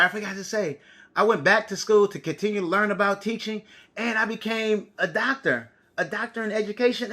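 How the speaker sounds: ordinary talk, nothing marked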